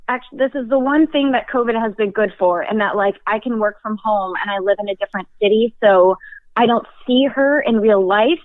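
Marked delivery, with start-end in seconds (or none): none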